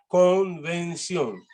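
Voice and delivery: "convención" said very slowly, each syllable drawn out; slow dictation. Shown as a demonstration. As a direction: stretch "convención" out slowly and clearly. Word repeated slowly for speller.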